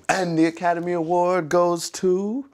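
loud, high, drawn-out announcement; mock awards announcer. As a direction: proclaim it like an Oscar presenter, slowly. Pretend Oscar announcement, teasing partner.

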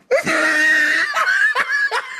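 high cackle; big laugh. Real, not acted. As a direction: burst out laughing. Laughing at karma story.

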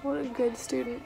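normal talking voice

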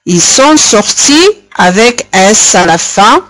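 ordinary talk

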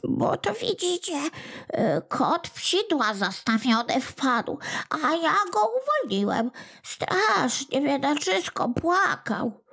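a guilty imp making excuses; whiny little goblin explaining. Acted. Kłobuk explaining himself to Smętek in a high, soft whine.

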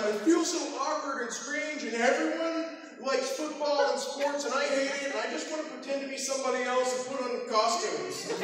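high-pitched, plaintive youngster; awkward younger self. Retold or imitated. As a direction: pour out a kid's awkward confession, high. Acts out his awkward younger self.

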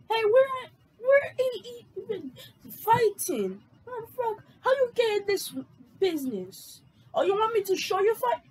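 hammed-up high fighter voice; high excited brawler. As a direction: pitch up into a hyped fighter. Acted street fight in script.

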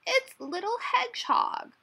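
very high, loud surprise; amazed exclamation. A narrator's reading. Badger recognising his friend in the snowball, amazed. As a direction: announce it high, astonished by who it is. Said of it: "he said in surprise".

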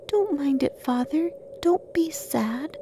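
put-on high, soft child voice; little child comforting. A narrator's reading. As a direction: console gently in a small high voice. Young children comfort their father.

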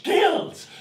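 high shouted gills; horrified cry. Acted. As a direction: yelp the word in horror.

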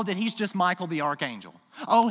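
ordinary talk, nothing marked

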